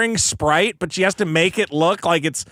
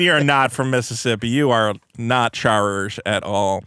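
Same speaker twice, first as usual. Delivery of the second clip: ordinary delivery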